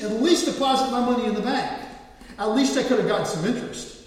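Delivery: a master scolding his lazy servant; stern rebuke. Retold or imitated. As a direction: build from stern to loud rebuke.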